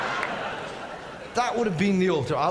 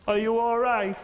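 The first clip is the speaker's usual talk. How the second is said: small, higher voice from the back; little voice; 5 semitones higher than usual. Retold or imitated. Someone at the very back to the comedian, little voice. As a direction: mimic a timid little onlooker calling out. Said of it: "this little voice went".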